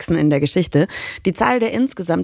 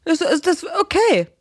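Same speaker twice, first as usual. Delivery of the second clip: hesitant; 10 semitones higher than usual. Real, not acted. Reacting to Malfoy impression.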